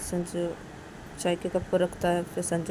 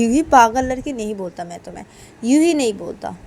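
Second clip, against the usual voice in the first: louder, slightly raised retort; acted quarrelling husband, mildly heated; exasperated jibe; 3 semitones higher, 7 dB louder than usual. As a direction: justify the insult with exasperation. Sanju insults Uma in quarrel.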